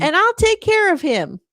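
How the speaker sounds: high, loud folksy caricature; Alec. Retold or imitated. Alec calls a boy's dad about the accident, hammed-up reassurance. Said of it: "And he's like".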